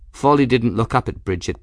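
plain reading voice

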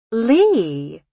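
high, drawn-out musing on a name. Acted. Mother considering the fiancé's surname, musing. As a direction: stretch it high, turning it over. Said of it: "her mother mused".

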